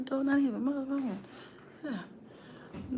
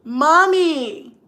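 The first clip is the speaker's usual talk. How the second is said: imitating kid; 5 semitones higher, 5 dB louder than usual.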